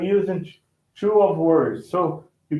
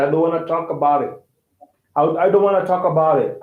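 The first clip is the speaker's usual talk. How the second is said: fast linked speech; much faster than usual. Shown as a demonstration. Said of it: "don't put out there".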